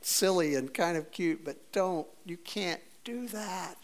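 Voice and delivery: fond, amused God. Retold or imitated. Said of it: "God looks at us and goes".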